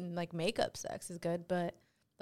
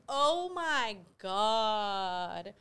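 drawn out oh my god; 2 semitones higher, 3 dB louder, much slower than usual. Retold or imitated. Reacting to lyric.

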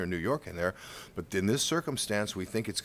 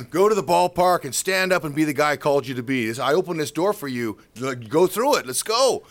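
loud, raised pep talk; rousing command; 4 semitones higher, 7 dB louder than usual. Retold or imitated. God sending a discouraged man to the ballpark, rousing. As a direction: urge him on forcefully.

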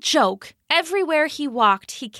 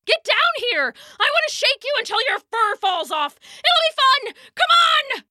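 much higher, fast, building to a shout; eager taunting; 12 semitones higher than usual. Acted. Dog begs the squirrel to come down, building to a shout.

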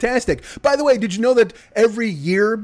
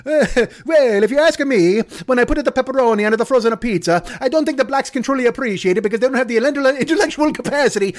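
higher, haughty pipe-smoking pundit voice; pompous Mama Celeste; 3 semitones higher than usual. Acted. Mama Celeste lectures about pizza, pompous pundit voice. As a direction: pontificate like a self-important expert. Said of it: "gets out a Sherlock Holmes pipe".

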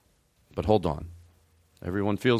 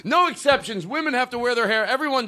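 high mock repeat; 12 semitones higher than usual. Mocking repeat of guest.

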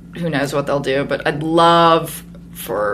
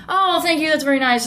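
high, sugary polite thank-you; mock gracious thanks; 10 semitones higher, faster than usual. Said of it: "I have to kinda go".

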